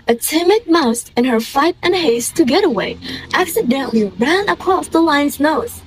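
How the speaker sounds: usual speaking voice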